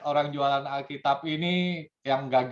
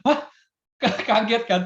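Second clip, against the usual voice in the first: laughing through the words; 8 semitones higher, 4 dB louder than usual. Real, not acted. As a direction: chuckle as you say it. Laughing at own story. (sambil tertawa)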